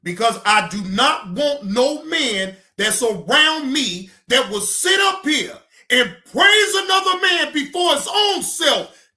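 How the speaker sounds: loud, heated rant; ranting shout. Real, not acted. Ranting at viewers about a man who mimics. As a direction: shout down at a man you despise. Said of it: "I didn't mean to scream".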